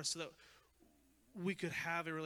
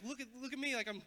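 excited servant; 6 semitones higher than usual. Retold or imitated. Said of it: "excited, like, happy".

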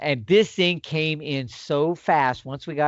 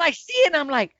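excited retelling; 10 semitones higher, 3 dB louder than usual. Retold or imitated.

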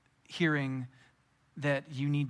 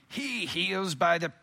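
slow, higher mocking accuser voice; hammed-up, drawn-out accusation; troublemakers' voice; 4 semitones higher, slower than usual. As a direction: put on a pompous accuser. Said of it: "that's how they talked".

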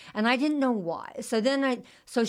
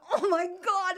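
mortified oh my god; 10 semitones higher, slower than usual. Retold or imitated. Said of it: "And I'm like".

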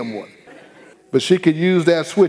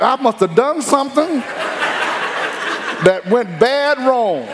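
put-on child's high realization; 9 semitones higher than usual. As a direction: puzzle it out like a scared kid. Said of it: "He had to figure out".

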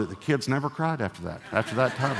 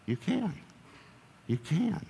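gentle encouragement; 6 semitones higher, 7 dB quieter, slower than usual. Acted. Preacher voicing God's encouragement.

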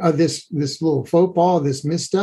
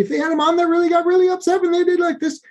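much higher, faster, as if getting upset; upset high pitch; 12 semitones higher, faster than usual. Shown as a demonstration. Therapist shows how a mom's upset voice sounds, high and fast. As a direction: pitch it up like an alarmed mom.

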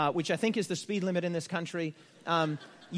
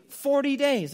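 "forty" hit higher and louder; stress on forty; 5 semitones higher, 5 dB louder, slower than usual. Shown as a demonstration. Preacher insisting on the exact number to the church, stressing "forty". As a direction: land hard on "forty" after the list. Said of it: "not thirty-eight, not thirty-nine".

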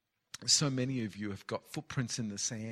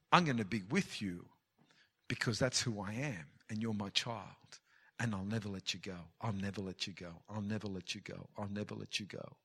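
soft, lower, fading to a near hush; tender promise; 4 semitones lower, 4 dB quieter than usual. Acted. God promising a child he will never let go, soft and tender. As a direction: reassure them gently, as God.

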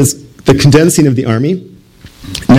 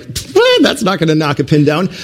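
mocking scoff; 2 semitones higher than usual. Congregation mocks the preacher's BB, scoffing. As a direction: scoff at the tiny BB. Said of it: "you guys would all be laughing at me like".

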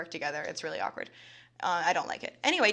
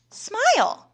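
imitating the creep's high sing-song "Smile"; mocking the stranger at her desk, a high "Smile"; 12 semitones higher, 3 dB louder than usual.

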